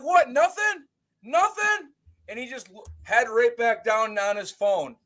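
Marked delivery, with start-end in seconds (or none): 0.0-1.9 mocking taunt. Taunting silent kid.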